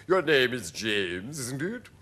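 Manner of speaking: slow centipede character voice; drawn-out creature voice. A narrator's reading. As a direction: quiz the newcomer as a showy centipede. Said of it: "the centipede said".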